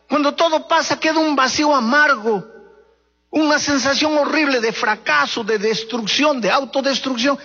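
fervent preacher at full force; impassioned preaching. Acted. Litany "quiere ser feliz" preaching.

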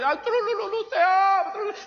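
a high, sugary mock-seductive croon; sing-song it like a sweet-talking tempter. Preacher mocking a godless man's sweet talk, high croon. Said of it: "esa voz dulce".

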